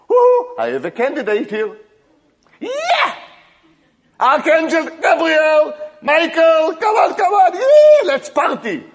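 very high, loud, laughing party shouts; a jubilant father throwing a party; ecstatic celebration. Acted. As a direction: cheer and call the angels to the party.

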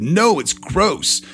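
mildly acted stubborn little boy, dragging each word; child's refusal. Young son refusing his dinner, stubborn protest.

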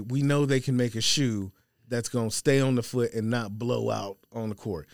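normal talking voice

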